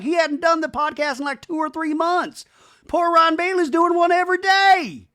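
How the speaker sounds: high, loud whiny imitation; hammed-up distraught wife; mock upset Brittany. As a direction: pitch it way up like an aggrieved rant. Mimicking Brittany's upset rant.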